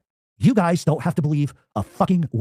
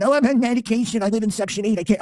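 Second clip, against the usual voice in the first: high whiny mock voice of a coward; mock the rival's lame excuses, whining high; 7 semitones higher than usual. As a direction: sneer out his excuses in a higher voice. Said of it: "You'll find some reason".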